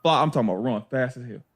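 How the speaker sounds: usual speaking voice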